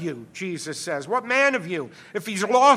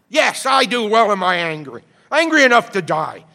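Jonah's angry retort; 3 semitones higher, 9 dB louder than usual. Acted. Jonah answers God's question in a loud, defiant rage. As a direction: throw it back at God in a sulking rage. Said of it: "Do you do well to be angry".

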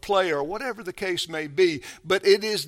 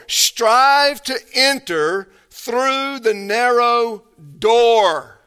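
slow, weighty scripture read with STRIVE stressed; emphatic verse reading; 5 semitones higher, 7 dB louder, much slower than usual. Acted. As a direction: lean hard on "Strive" and slow down.

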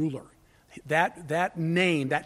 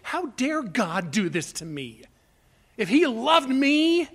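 slow protest, climbing higher and louder; mock indignation; 6 semitones higher, 3 dB louder than usual. Preacher acting out the sinful flesh protesting to God, mock indignation. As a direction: voice the self-pitying complaint, stretched out. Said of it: "flesh says".

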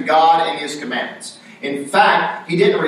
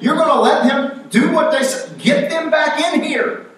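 much higher, pressing voice; urgent servants; 10 semitones higher than usual. Retold or imitated. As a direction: beg the king to call them back. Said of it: "And they said".

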